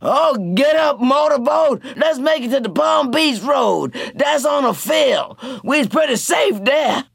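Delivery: high, loud pleading shout; urgent shout. A narrator's reading. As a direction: yell at him to get up and go. Tea Cake urging Motorboat.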